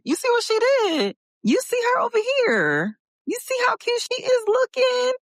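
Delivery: very high-pitched, excited gushing. Real, not acted. Host shows off her pink blend to viewers, gushing in a high voice. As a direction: squeal with delight over how cute it is.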